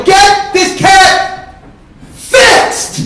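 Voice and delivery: very high, drawn-out furious shout; enraged bellow at breaking point; full-force scream. Acted. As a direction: scream each word slowly, pause before the last. Climax of acted shouting match.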